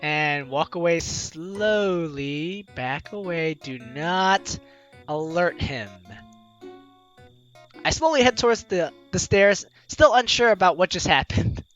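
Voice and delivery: ordinary talk, nothing marked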